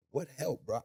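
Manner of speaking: ordinary delivery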